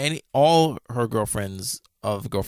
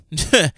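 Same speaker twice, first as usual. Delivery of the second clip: quick, high burst of laughter; a loud little chuckle; short laugh; 8 semitones higher, 8 dB louder than usual. Real, not acted. Clarifying a mix-up to listeners, amused short laugh.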